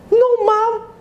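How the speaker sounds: put-on very high-pitched child's protest; refusing child. Child refuses mother's order to sleep, high protest. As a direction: protest in a high little kid voice.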